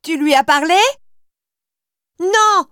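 high desperate cry; shout. Acted. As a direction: cry it out in tears. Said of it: "Il crie".

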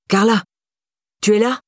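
tense call at the door; mildly strained calling. A narrator's reading. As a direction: call out, a touch on edge. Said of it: "Il était tendu et cela s'entendait".

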